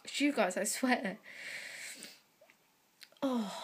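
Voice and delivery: almost crying, pushing the words out through a tight throat; tearful, voice breaking. Real, not acted. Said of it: "I didn't wanna cry".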